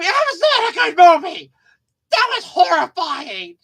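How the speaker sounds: hammed-up old man's disgust; mock outraged grandfather.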